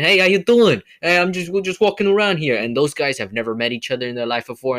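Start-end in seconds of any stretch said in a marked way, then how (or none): 0.0-2.6 playful New York street greeting, pitched up and loud; New York accent. Retold or imitated. Podcaster mimicking New Yorkers chatting on the street, hammed-up New York accent. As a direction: ham up a friendly New York guy saying hi. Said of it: "a full-on New York accent".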